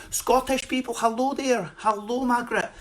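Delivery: high-pitched mock Scottish greeting; Scottish accent. Comic greeting Scottish viewers, mock Scottish accent.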